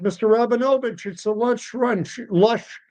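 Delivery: high, hurried deli owner; harried deli owner. Retold or imitated. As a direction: rush it like a busy deli man. Busy owner answers the customer.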